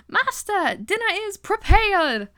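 high, loud servant's announcement; Magenta. Retold or imitated. Servant breaks awkward tension announcing dinner, high theatrical call. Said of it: "she's like".

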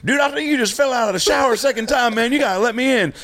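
worried buddy. Retold or imitated. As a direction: urge him to open the door. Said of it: "He goes".

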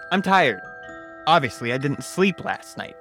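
plain reading voice

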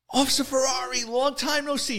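high-pitched mock-friendly informant voice; Tiny's put-on friendly greeting. Caught informant greets the officer, high and ingratiating.

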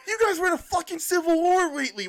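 high, exasperated mock scolding; playful high-pitched indignation; mock outrage. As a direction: tell them off in hammed-up disbelief. Mock telling-off of Spain.

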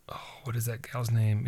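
muttered under the breath, unvoiced; whispered to self. Real, not acted. Host whispering to himself while searching for a guest's name. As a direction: whisper it to yourself while trying to remember.

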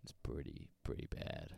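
soft unvoiced whisper. Real, not acted. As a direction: whisper it aside. Whispered aside.

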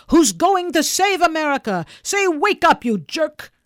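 loud, high-pitched rousing holler; boisterous calling out. Acted. Popper poking the man in front, boisterous hollering. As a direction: holler at someone to rouse them.

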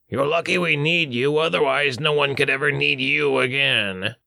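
high, threatening dwarf voice. Acted. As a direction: warn him as the grumpy dwarf.

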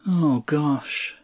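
slightly high, sinking sigh of dismay; crestfallen little boy. A narrator's reading. Boy reads father's look, crestfallen. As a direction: let the words fall in disappointment. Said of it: "He read the look".

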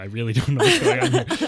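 high, hammed-up bewildered protest; retold freak-out. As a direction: act out helpless panic, loudly. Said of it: "and I'm freaking out".

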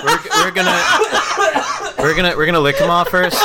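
laughing. Real, not acted. Joking through laughter.

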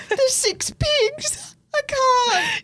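very high, squeezed out through laughing; so amused the words barely get out; helpless laughter. Real, not acted. Reacting to the six pigs story, helpless laughing.

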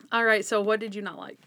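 ordinary talk

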